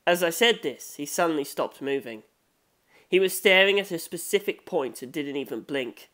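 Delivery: ordinary delivery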